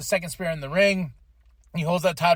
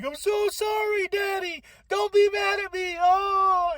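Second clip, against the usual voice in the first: high, whining apology to daddy; mock tearful wail, much higher; whiny pleading plea; 14 semitones higher than usual. Acting a pleading wrestler quote.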